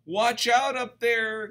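high, slow then loud warning; a worried friend shouting a warning; alarmed cry. Acted. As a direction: call up in alarm. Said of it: "good Trevor did cry".

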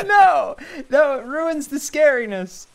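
high laughing protest; giggling through the no. Real, not acted. Laughing refusal.

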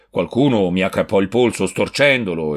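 usual speaking voice

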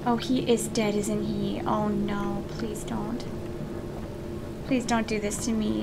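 soft, low, sinking plea; hushed dread. Real, not acted. Player begging the game to spare a favorite, hushed dread. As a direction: plead quietly, voice dropped low. Fearing favorite character is dead.

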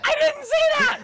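high, loud panicked denial; panicked shout. Real, not acted. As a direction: yell it out in disbelief. Denying an accusation in panic.

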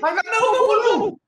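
very high desperate shouts; frantic yelling. Real, not acted. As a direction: scream as the shot goes wide. Near miss chance.

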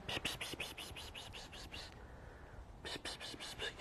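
rapid, voiceless repeated pss sounds; cat-luring psst. Real, not acted. Luring Songül over, whispered psst calls. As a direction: softly hiss a string of calls to a cat.

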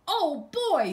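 put-on high, loud delighted "oh boy"; excited boy. Doug thrilled to see the sheep, high and loud. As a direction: squeal it like a thrilled kid. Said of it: "said Doug".

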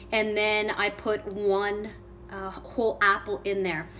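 ordinary talk, nothing marked

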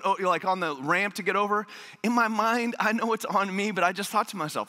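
ordinary talk

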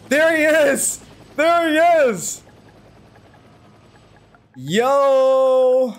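there he is, stretched out and beaming; excited greeting. Real, not acted. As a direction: hail your buddy like he just walked in. Greeting arriving friend.

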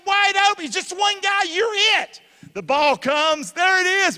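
high, loud commentator build-up; excited play-by-play. Acted. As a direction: call the pass like live commentary.

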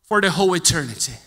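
loud, high, driving sermon voice; preaching at full force. Acted. Preacher urging the church in a loud, high sermon voice. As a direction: pound the message home from the pulpit.

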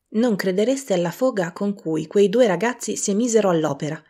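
ordinary delivery

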